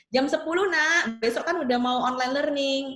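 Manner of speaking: acted insistent parent; urging parent. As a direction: press your child to go to bed. Acted parent urging child to sleep.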